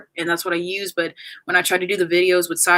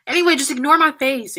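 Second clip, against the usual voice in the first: tearful; 7 semitones higher, 5 dB louder than usual. Real, not acted. Crying, trying to move on.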